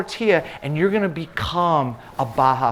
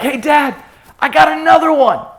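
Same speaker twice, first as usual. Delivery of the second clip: put-on higher, louder excited kid calling out; excited son; 10 semitones higher, 8 dB louder than usual. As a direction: call across the boat like a thrilled boy. Excited son voiced in story.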